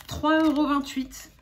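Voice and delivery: loud, high, slow price announcement; slow emphatic price reveal; triumphant repeat. Real, not acted. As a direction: hammer the bargain home, drawn out. Repeats the tiny total for effect.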